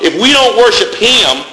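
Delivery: ordinary talk, nothing marked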